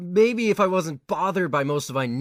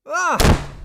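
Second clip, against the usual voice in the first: sudden loud high yelp; startled yelp; 8 semitones higher, 7 dB louder than usual. Acted. Godai yelps when Yatsuya seizes him. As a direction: yelp as you are grabbed.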